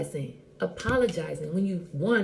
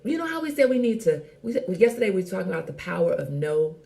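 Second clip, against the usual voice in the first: ordinary delivery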